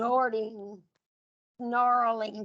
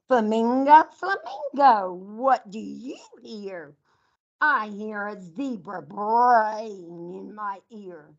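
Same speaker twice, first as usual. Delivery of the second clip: lively storytime read, louder on the animal names; reading to children; 7 dB louder, slower than usual. Acted. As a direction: call out the names like a game for the class. Teacher reads animal picture book.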